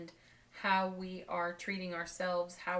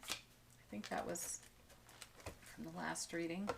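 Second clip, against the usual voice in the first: whispered aside; 11 dB quieter than usual. Real, not acted. Quiet aside about a card.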